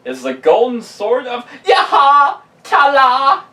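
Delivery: high, loud triumphant laugh-shout; excited laughing shout. Real, not acted. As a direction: announce the prize with glee. Announcing the great item.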